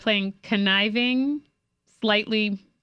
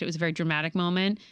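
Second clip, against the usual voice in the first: ordinary talk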